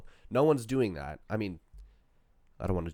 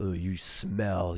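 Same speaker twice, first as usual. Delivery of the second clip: low, slow, savouring voice; mock lustful partner; 7 semitones lower, 4 dB quieter, much slower than usual. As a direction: draw it out in pretend desire. Plays someone into partner's smell.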